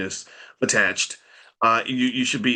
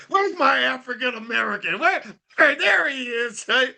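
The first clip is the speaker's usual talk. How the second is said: Trump impression; 13 semitones higher than usual. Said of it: "he's like".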